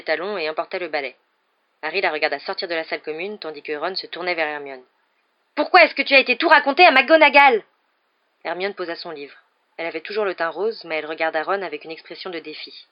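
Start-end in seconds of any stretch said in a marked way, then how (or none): 5.5-7.6 high, loud angry demand; furious accusation. Acted. Boy confronting girl who told the professor, furious. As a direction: shout it accusingly at a friend.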